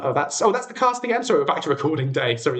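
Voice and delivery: normal talking voice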